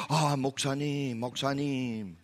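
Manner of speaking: put-on Moksanim said slowly with a Korean accent, bowing in tone; mock-reverent Korean pastor's greeting; Korean honorific greeting. Preacher mimics Korean churchgoers greeting a pastor, reverent. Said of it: "and say".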